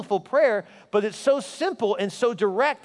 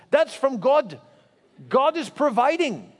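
put-on little girl certain; 6 semitones higher, 3 dB louder, slower than usual. Little girl excited at package.

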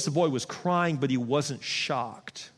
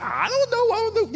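mock bewilderment; 3 dB louder than usual. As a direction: play a clueless coordinator, voice way up high. Coordinator baffled by the kid.

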